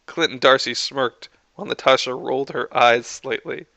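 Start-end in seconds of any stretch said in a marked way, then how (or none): none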